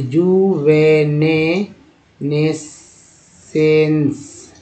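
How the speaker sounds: जुवेनेसेंस stretched very slowly, each syllable apart; slow demonstration. Shown as a demonstration. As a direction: spell out जुवेनेसेंस slowly so they can copy. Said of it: "और देखिए". (जुवेनेसेंस धीरे-धीरे बोलकर)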